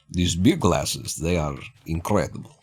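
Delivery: a half-French Soviet accent, played for laughs; mock Soviet accent. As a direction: sell the glasses in a hammed-up Soviet accent. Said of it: "my Soviet accent is slightly French".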